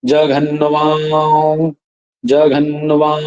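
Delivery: जघन्वां stretched very slowly and heavily; rough pronunciation. Shown as a demonstration. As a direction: say जघन्वां drawn out, slow and rough. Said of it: "कितना रफली उच्चारण हो रहा है". ("जघन्वां" को बहुत धीरे, भारी और खींचकर बोलिए)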